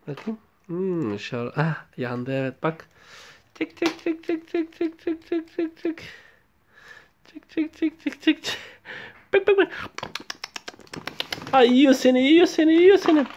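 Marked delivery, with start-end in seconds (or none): 3.5-8.6 high, soft tick-tick voicing of a toy; toy sound. Father voices toy tapping sounds. 9.3-13.3 playful mock threat. Playing toy eating the child.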